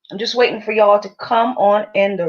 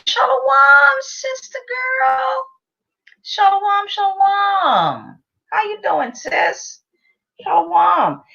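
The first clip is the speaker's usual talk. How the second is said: high greeting; 12 semitones higher, slower than usual. Pretend. Greeting a guest, high pitched.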